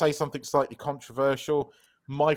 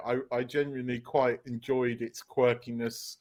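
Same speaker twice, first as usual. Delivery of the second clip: normal talking voice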